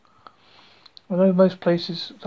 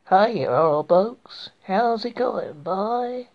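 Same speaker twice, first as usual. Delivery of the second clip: badly faked, drawn-out American accent; slow, unconvincing put-on American twang; poor American accent; 3 semitones higher, much slower than usual. Shown as a demonstration. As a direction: have a clumsy go at American. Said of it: "my really poor attempt at American accent".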